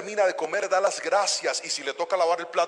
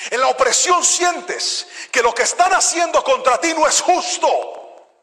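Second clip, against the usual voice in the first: rising, louder fervent preaching; 4 semitones higher, 8 dB louder than usual. Acted. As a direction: build the congregation up with fervour. Preaching builds up.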